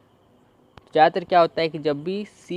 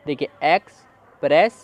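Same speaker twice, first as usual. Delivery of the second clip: Express said slowly and louder, each syllable clear; slow model word; 5 dB louder, slower than usual. Shown as a demonstration. Teacher modelling the word for pupils. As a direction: hold out express for the class to copy. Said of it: "ड".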